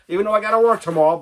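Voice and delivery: fast, laughing through the words; rushed giggly aside. Real, not acted. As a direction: chuckle it off quickly. Laughs about work tomorrow.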